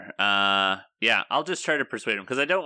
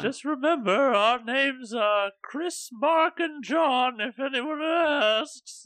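high silly voice; slower than usual. Acted. Character's conspiratorial parting line.

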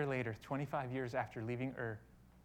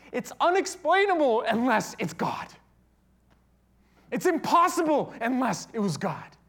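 loud, high, full-force sermon; ringing pulpit proclamation, pitched high; impassioned preaching; 10 semitones higher, 13 dB louder than usual. Acted.

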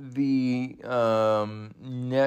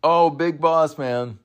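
louder, higher thrilled announcement; excited call; 5 semitones higher, 7 dB louder than usual. Real, not acted. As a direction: greet the entrant with a burst of excitement. New entrant appears.

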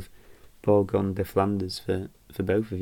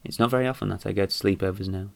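ordinary talk

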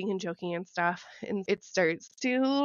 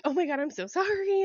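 higher, flustered sorry; mock mortified apology; 7 semitones higher, faster than usual. Embarrassed woman apologizing to her date for smelling, mock flustered. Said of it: "I was like".

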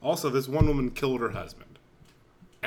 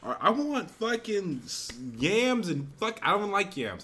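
higher, slow, half-laughing complaint; a mock tantrum over side dishes; exaggerated rant; 7 semitones higher, 4 dB louder, slower than usual. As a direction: rant like a spoiled kid at dinner. Ranting complaint about the food.

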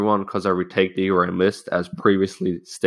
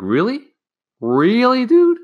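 mock disbelief, shooting up high and louder; retold incredulity; 6 dB louder, slower than usual. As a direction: squeal it in exaggerated disbelief. Incredulous reaction to classmate's tantrum.

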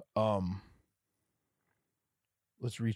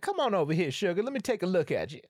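higher, louder sugary grandma; grandma sweet talk; 8 semitones higher, 4 dB louder, faster than usual. Retold or imitated. Grandma calling her grandson over, sweetly higher. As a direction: call him over like a sweet southern grandma. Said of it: "she's like".

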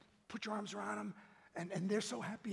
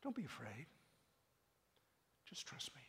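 gentle reassurance; 5 semitones lower, 11 dB quieter than usual. Retold or imitated. Jesus voiced gently.

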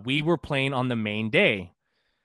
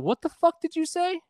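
high, sharp mock outrage at a rude stranger; angry retort. Teen snapping at a rude band member, mock outrage. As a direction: snap back, much higher in pitch. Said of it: "And I was like".

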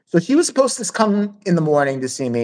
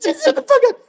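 very high squealed outburst; exasperated mock screech. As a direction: imitate a girl snapping at her tormentor. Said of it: "she finally gets to the point".

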